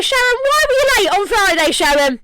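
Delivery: high, loud nagging manager; mock manager grilling Sharon about lateness, high and loud; mock boss voice. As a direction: play a petty boss demanding an excuse. Mock boss scolding worker.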